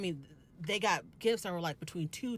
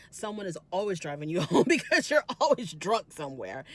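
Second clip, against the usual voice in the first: louder, laughing through the jab; laughing dig; 8 dB louder than usual. Retold or imitated. Mary mocking Heather's drinking, laughing louder. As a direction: tease her while breaking into a laugh.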